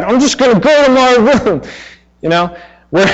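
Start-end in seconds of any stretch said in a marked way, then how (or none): 0.0-1.6 high mock pout of a child talking back; sulky child. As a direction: play the huffy kid storming off. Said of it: "when they are talking back".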